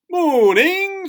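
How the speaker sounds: very high, loud, sung-out greeting; cheerful. A narrator's reading. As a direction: call it out with oblivious cheer. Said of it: "said his cousin cheerfully".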